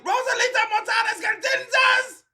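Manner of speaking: high-pitched hollering mother; mock mom's scream for her daughter; mama yelling. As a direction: holler the full name like an angry mama. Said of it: "I bet her mama get".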